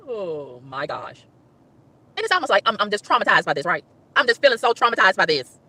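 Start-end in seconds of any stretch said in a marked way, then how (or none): 0.0-1.2 very soft, low, slow; long, low sighed lament; exasperated sigh. Real, not acted. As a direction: breathe it out slowly, spent. Exhausted sigh after ranting.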